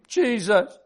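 grieving child's cry, lightly acted. A narrator's reading. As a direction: act out a little girl crying instead of screaming. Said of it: "began to cry instead of scream".

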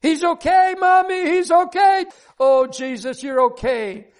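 very high-pitched squeal of joy, then easing; overjoyed high cry to mom; jubilant child's cheer. A narrator's reading. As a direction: act out a little girl cheering in ecstatic relief. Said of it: "A Super Bowl touchdown cheer".

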